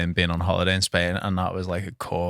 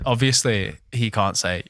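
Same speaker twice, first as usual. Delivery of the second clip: ordinary talk, nothing marked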